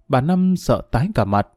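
plain reading voice

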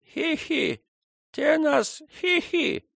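put-on high, slow toothless old woman; toothless mumbling. A narrator's reading. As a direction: gum the words like a toothless crone. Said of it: "шамкая беззубыми дёснами".

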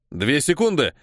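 ordinary delivery

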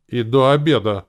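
loud, emphatic rumble; rumbling. A narrator's reading. Gronk adding a deadline for the payment, rumbling. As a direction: rumble it out forcefully. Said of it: "пророкотал Гронк".

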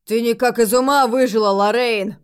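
loud, lightly voiced burly man; booming bass man. A narrator's reading. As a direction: boom it out with a hint of a big man. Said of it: "пробасил ближайший к нам бородач".

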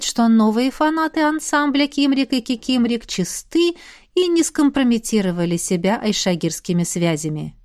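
plain reading voice

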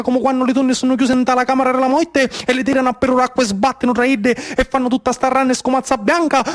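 put-on lifted voice reliving a childhood game; excited telling. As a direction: tell it like a child still thrilled by it. Splashing game told excitedly.

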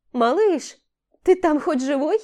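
calling up, worried; called from below. Acted. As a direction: shout up from below. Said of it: "донеслось снизу".

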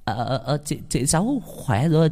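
a hint of a shaken grandmother; flustered old woman. Startled old woman recovers.

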